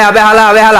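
loud, high mock Old Dhaka street talk; loud teasing Old Dhaka "আবে হালা"; Old Dhaka slang. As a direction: shout it like a Dhaka local. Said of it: "খালি কয়".

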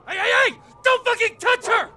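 loud, high, desperate yelling; furious yell. Acted. Man yelling at soldier grabbing his wife, furious. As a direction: yell to stop him grabbing her.

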